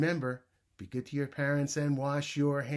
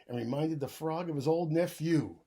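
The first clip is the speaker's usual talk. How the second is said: put-on lively read-aloud for young kids; story reading; 4 semitones lower, 7 dB louder than usual. A narrator's reading. Teacher reading a bedtime story to the class, animated. Reads picture book aloud.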